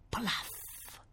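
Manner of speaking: hushed, voiceless plop sound; soft plop. A narrator's reading. Object landing softly on the balcony, whispered plop. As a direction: puff out a gentle splat. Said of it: "Emise soltanto un morbido".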